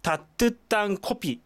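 タットゥッタンコピ said quickly as Korean; Korean pronunciation demo. Shown as a demonstration. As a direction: read タットゥッタンコピ briskly in Korean sounds. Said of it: "温かいコーヒー". (韓国語の発音で速めに)

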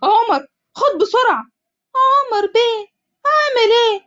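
high, slow, frantic plea; خائف ومذعور; panicked. Acted. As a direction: beg for help in a rush of fear. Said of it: "محمد بفزع".

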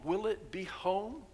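ordinary talk

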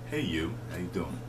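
low, soft flirty greeting. Shown as a demonstration. Showing how "hey you" is almost flirting, flirty. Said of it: "almost like flirting".

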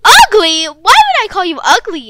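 loud, stunned denial; indignant disbelief. Acted. Maddie denies insulting her friend, outraged. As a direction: throw the word back in disbelief.

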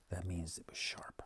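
soft whispered explanation to the listener; whispered aside. A narrator's reading. Narrator whispering an aside to young listeners. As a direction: lean in and whisper it.

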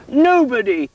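hammed-up royal whine; whimpering king. Sulking king whining to the queen, mock whimper. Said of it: "he whimpered".

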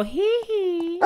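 high-pitched falsetto hee hee; pop-star style high hee hee; hee hee squeal. Pretend. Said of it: "when I go".

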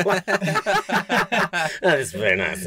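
slow, hammed-up Italian accent, laughing through it; mock Italian accent. Podcast host teasing the racer's understatement, in a hammed-up Italian accent. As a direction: drawl "That is very nice" in a bad mock Italian accent. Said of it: "That was my Italian accent".

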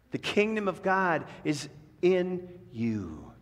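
ordinary talk

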